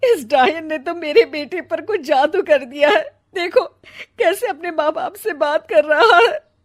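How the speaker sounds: high, loud, tearful curse; mother lamenting the son under a spell; bitter wail. Acted. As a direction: wail bitterly at the witchy bride. Mother cursing the bride.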